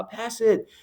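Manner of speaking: high-pitched imitated sideline yell; crowd yelling. Crowd yelling at a young player to pass, mock shout. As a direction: mimic fans shouting orders from the stands. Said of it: "a chorus of people just started yelling".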